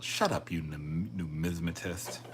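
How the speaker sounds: ordinary talk, nothing marked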